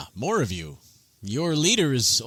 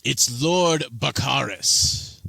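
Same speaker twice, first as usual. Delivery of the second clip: slow, stiff, offended noble; indignant correction; 3 semitones higher, 4 dB louder, much slower than usual. Acted. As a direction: correct them through pride. Bakaris corrects the nickname.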